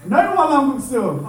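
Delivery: loud, high mock scolding; angry mom. Angry mom refusing her child the noodle treat, loud mock anger. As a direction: imitate a mother laying down the law. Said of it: "my mom's really angry".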